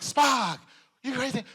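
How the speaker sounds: mock Kirk; Captain Kirk impression. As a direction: overdramatic starship captain. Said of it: "this Captain Kirk".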